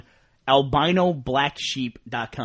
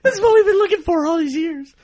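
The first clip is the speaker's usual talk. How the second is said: mock tearful joy; 6 dB louder than usual. Mock joy at finally being recognized.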